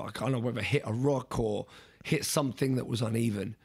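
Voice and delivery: ordinary delivery, nothing marked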